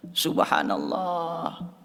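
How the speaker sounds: soft, drawn-out reverent exclamation; hushed, very slow marvelling; awed praise. Real, not acted. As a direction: breathe it out slowly in wonder. Preacher marvels at the story.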